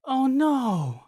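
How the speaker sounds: cute anime streamer. Acted. Cutesy voiced streamer.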